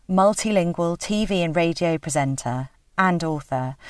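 measured host read introducing guests; presenter introduction. Acted.

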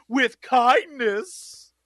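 very slow, drawn-out mock sweetness; mock gentle. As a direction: stretch it out like a sappy promo. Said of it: "next episode, it's like".

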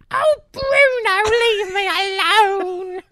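high, loud, slow exaggerated Cockney accent; mock Cockney. Teasing the actor's Cockney roots, slow squeaky Cockney. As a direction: drag it out in a squeaky Cockney whine. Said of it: "extremely strong Cockney accent".

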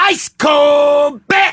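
loud, slow, high hawker shout; beer vendor shout. Retold or imitated. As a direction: bellow it across the stands. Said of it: "What was that?".

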